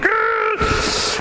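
very high strained "rrr"; cartoon fuming growl. Dad fuming at his daughter's teasing, cartoonish strained growl. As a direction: let steam out of the ears like a cartoon. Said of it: "steam out of the ears like the old Flintstones".

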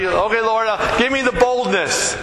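high-pitched, hesitant plea; acted prayer. Hesitant believer asking God for boldness, high acted plea. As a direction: pray it like a reluctant volunteer.

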